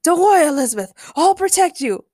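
high, loud storybook hero; hammed-up heroic declaration; proud proclamation. A narrator's reading. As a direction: proclaim it gallantly. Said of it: "Will proudly proclaimed".

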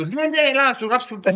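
high, eager bank clerk welcoming a client; eager banker voice. Retold or imitated. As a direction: gush like a banker who can't say no. Banker eagerly welcoming a government employee.

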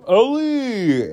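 high, loud drawn-out name call; retold yell. Jake at the car window calls to Ellie, retold yell. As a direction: imitate a frantic call through glass.

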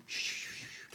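soft hushed shushes; low murmuring crowd shh; crowd shushing. Acted. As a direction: imitate onlookers hushing each other. Game master voices hushed onlookers.

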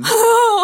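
high, loud teary laugh; a crying laugh that breaks out; laugh through tears. Real, not acted. Woman comforted by a colleague, teary laugh.

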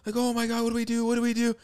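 high-pitched mock panic of a mom; imitated freaking out. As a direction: squeal it like a panicking mother. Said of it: "my mom starts freaking out".

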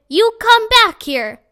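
high, loud, slow yell; furious yell after the dog; shouting. Acted. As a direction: holler after the running dog. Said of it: "Ernest shouted".

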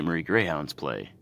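ordinary delivery

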